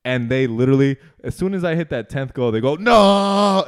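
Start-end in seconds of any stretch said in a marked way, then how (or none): 2.8-3.6 high, loud drawn-out cry of defeat; hammed-up howl of dismay; mock anguished shout. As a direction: wail it like a sore loser. Losers react to the final goal.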